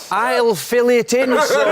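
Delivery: high, slow laughing Clough voice; mock Clough, laughing. Retelling gaffer's blank contract line, slow laughing mock Clough. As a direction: drag it out like the smug gaffer, laughing.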